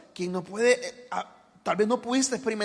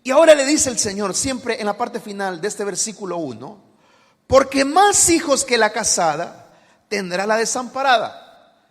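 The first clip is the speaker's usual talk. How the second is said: louder, slow fervent sermon voice; full-force preaching; 2 semitones higher, 11 dB louder, slower than usual. Acted. Preacher urging the congregation to rejoice, loud and slow. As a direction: build it up and urge them to rejoice.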